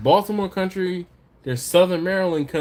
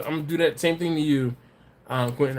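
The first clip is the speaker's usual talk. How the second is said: normal talking voice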